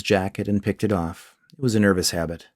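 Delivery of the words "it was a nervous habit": usual speaking voice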